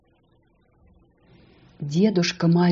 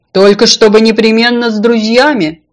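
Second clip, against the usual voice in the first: firm, slightly raised reminder; loud but conversational insistence; insistent condition; 3 semitones higher, 7 dB louder than usual. A narrator's reading. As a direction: spell out the one condition. Fish sets her condition.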